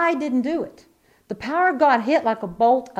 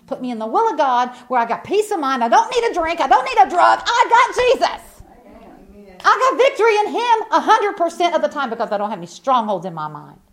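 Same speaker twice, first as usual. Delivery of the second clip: loud, high, rising testimony shout; full-force preaching; 5 semitones higher, 6 dB louder than usual. Real, not acted. Preacher declaring victory to the congregation, full-force preaching. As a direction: preach it louder and higher, building.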